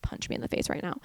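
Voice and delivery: low, fast mutter under the breath; exasperated barista. Acted. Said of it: "I bet the barista is just like".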